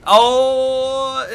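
long, loud, high drawn-out oh; shocked wail. Real, not acted. Gamer reacting to a big crash, shocked wail.

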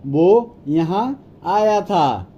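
word by word, with small gaps; teacher's spaced-out demonstration; slow model repeat. Shown as a demonstration. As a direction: separate each word slowly for learners. Slower repeat for learners.

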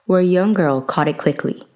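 usual speaking voice

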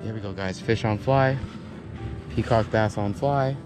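normal talking voice

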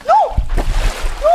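repeated panicked shouts of no; shouting no. Real, not acted. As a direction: yell it in real alarm. Baby falls down the toilet.

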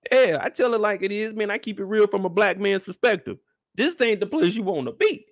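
usual speaking voice

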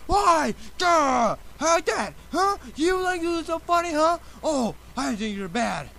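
screeching high and loud at the machine; furious high shout. Acted. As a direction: yell at it in a frenzy. Screaming at the broken register.

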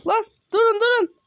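high, loud plea to halt; frightened shout to the others; panicked call. Real, not acted. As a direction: call out in alarm. Panicking, calls others to stop.

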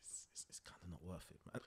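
hushed, low muttering; very soft re-enacted resignation; whispered aside. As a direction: whisper it like a cringing bystander. Onlooker quietly giving up on apology.

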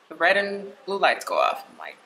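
ordinary talk

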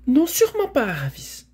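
high, loud, indignant mare; defiant refusal. A narrator's reading. Mare standing up to her rider, defiant and high. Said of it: "en rejetant ses oreilles en arrière".

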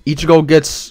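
normal talking voice

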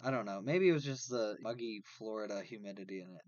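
ordinary delivery

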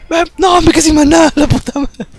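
high, loud cursing outburst; frustrated yell. Real, not acted. Player out of mana cursing, frustrated yell.

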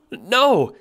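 mild stammered cry of no; a touch of stammering shock; weak stammered no. Acted. John stammering at the clown orb, weak cry. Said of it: "you stammer weakly".

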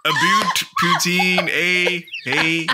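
mock Canadian, high and slow; bad Canadian accent. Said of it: "I don't do Canadian".

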